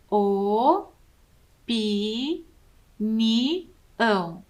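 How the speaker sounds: each syllable O, pi, ni, ão said apart; syllables spelled out. Shown as a demonstration. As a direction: tap out opinião as O-pi-ni-ão. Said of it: "quantas vezes eu abro a minha boca". (sílabas separadas, O, pi, ni, ão)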